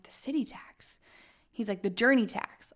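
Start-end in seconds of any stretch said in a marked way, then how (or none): 0.0-1.0 breathless whispered question; barely voicing it in shock; stunned whisper. To the host about the fee, whispered disbelief.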